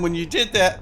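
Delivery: normal talking voice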